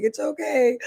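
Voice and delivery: retold very high little comforting voice; high-pitched reassurance. Finder reassures the reunited family, high-pitched comforting. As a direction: lift the pitch right up to reassure.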